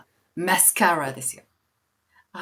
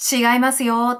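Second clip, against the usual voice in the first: higher, louder imitated objection; no-backtalk queen; 6 semitones higher, 6 dB louder, slower than usual. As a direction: imitate a queen who allows no complaints. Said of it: "文句言わせないみたいなクイーン".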